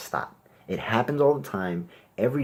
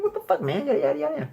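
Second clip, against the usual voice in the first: mock shocked; faster than usual. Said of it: "I'm like".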